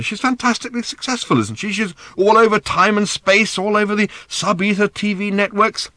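high-pitched, agitated defence; insistent. A narrator's reading. Father praises her mother's success, insisting. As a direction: argue it with rising urgency. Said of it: "insisted Arthur".

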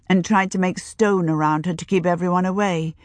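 ordinary delivery, nothing marked

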